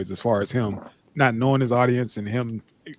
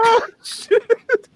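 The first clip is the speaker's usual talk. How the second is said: a high squeal of laughter on "oh"; laughing shriek; 4 dB louder than usual. Real, not acted. Reacting to his friend's story, laughing high.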